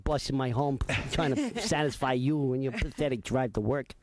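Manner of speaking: low gruff wiseguy voice; Joe Pesci impression. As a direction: grumble it like a mob guy. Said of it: "let me pull the string".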